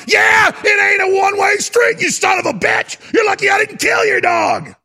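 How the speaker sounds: high, loud angry shouting back; retold furious yell. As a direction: yell it like you're facing him down. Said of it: "That's what I told him".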